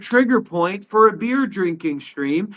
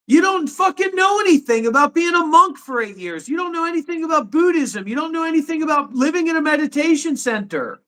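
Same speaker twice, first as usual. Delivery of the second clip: higher, heated telling-off; real indignant rant; angry outburst; 6 semitones higher than usual. Real, not acted. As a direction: lash back at the critic, raised pitch. Heated livestream argument with critic.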